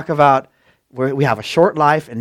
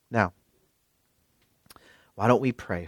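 normal talking voice

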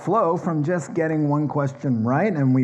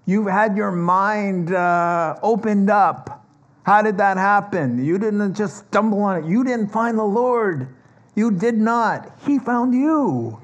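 rising, louder and higher sermon climax; fervent pulpit preaching, climbing in pitch; preaching at full force; 6 semitones higher, 3 dB louder than usual. Acted. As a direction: build it up like a preacher hammering home.

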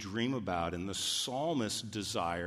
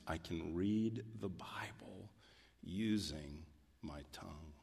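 whispered realization; 4 semitones lower, 10 dB quieter than usual. Retold or imitated. Realization quoted in sermon story.

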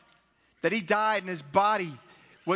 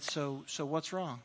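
gentle pastor question; 5 semitones lower, 9 dB quieter, slower than usual. Retold or imitated. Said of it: "he asked me, he said".

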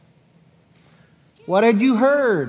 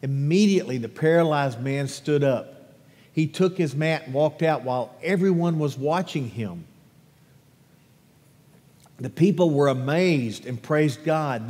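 measured, lower scripture reading voice; 5 semitones lower than usual. Acted. Pastor closes the gospel reading for the people, measured reading. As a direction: read the passage steadily to its close.

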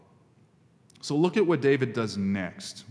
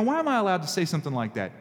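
ordinary delivery

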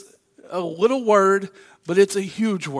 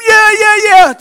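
much higher, loud eager yeahs; excited yeah; 12 semitones higher, 13 dB louder than usual. Retold or imitated. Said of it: "he went from".

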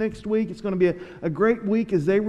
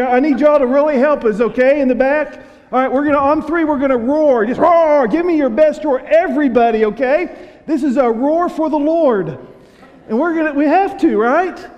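loud, hyped-up call to the crowd; loud rallying call; 5 semitones higher, 6 dB louder than usual. Real, not acted. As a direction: pump up the room, calling out loud. Rallying congregation to roar.

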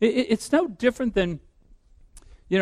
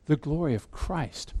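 ordinary talk, nothing marked

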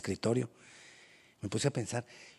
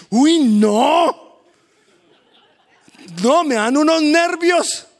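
high, loud, laughing nervous no; nervous refusal; 12 semitones higher, 13 dB louder, much slower than usual. Acted. A believer refusing the stage, high nervous laughing squeal.

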